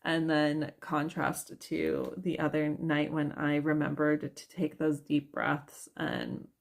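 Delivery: ordinary talk, nothing marked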